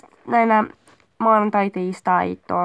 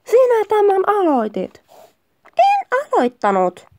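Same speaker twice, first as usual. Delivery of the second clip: high, exasperated acting; annoyed quarrel; 7 semitones higher than usual. Partner denying having started it, annoyed. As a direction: play it irritated, pitch jumping up. Acted quarrel, blaming each other.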